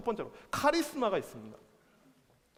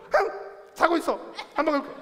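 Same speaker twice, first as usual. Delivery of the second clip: put-on loud, high-pitched childish pout; hammed-up sulk; 8 semitones higher, 4 dB louder than usual. King under blankets answering his attendant, hammed-up sulk. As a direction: huff and refuse from under the covers. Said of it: "이렇게 삐져 있어요".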